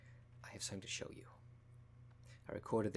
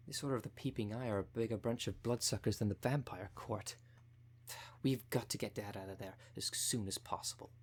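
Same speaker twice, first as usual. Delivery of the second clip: usual speaking voice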